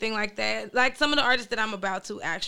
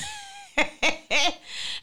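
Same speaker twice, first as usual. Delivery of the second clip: high laugh. Real, not acted. Lady K laughing at her own phone story, high burst.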